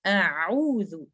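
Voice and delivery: "आऊज़ु" drawn out slowly with a light hamza; Quranic recitation. Shown as a demonstration. Teacher reciting the example word, slow. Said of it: "आ, Hamza".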